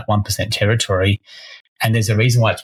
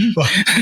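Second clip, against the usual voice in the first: a quick burst of real laughter; sudden high laugh; short laugh; 10 semitones higher than usual. Real, not acted. Amused reaction to client story.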